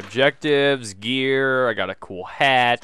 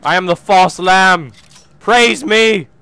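high, loud hammed-up prophet voice; over-the-top false messiah; mock preacher; 8 semitones higher, 11 dB louder, slower than usual. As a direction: proclaim yourself grandly.

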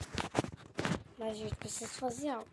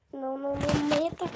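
being chased; 3 semitones higher, 8 dB louder, faster than usual. Real, not acted. Panic while running.

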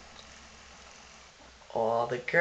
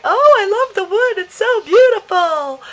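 high, loud gushing praise; gushing visitor; 10 dB louder than usual. Retold or imitated. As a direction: gush over it mockingly. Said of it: "Everybody who comes in the house is like".

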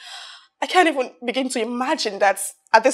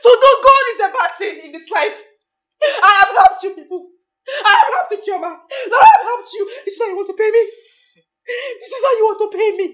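angry shouting; 6 semitones higher, 7 dB louder than usual. Acted. Shouting accusations.

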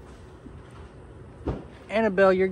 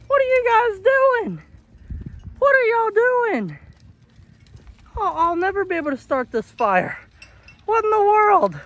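exasperated disbelief; 10 semitones higher than usual. Real, not acted. Owner to goats wrecking the firewood, high exasperated disbelief. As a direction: wail it in baffled exasperation.

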